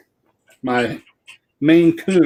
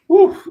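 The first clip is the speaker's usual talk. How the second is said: woo; 6 dB louder than usual. Real, not acted. Complaining room is hot.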